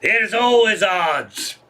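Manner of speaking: put-on slow, drawn-out drunk pub voice; imitating a drunk at the bar; drunken Irish guy. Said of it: "another drunken guy from Ireland at the bar".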